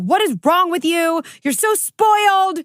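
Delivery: high, loud yell, slowing on spoiled; yelling at son. Retold or imitated. Mother exploding at her son in the kitchen, yelling. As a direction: snap at him in a scary, reactive way. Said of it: "And I just yelled at him".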